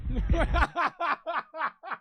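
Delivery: loud high helpless laugh; sudden loud real laughter; burst of laughter. Real, not acted. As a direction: crack up at the clip. Laughing at the Clay call clip.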